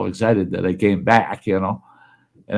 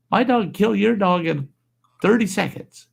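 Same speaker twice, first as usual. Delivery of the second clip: high-pitched, indignant biker boast; imitated boast; 9 semitones higher than usual. Biker bragging on his Doberman, imitated high indignant boast. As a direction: brag as the affronted biker, pitched well up.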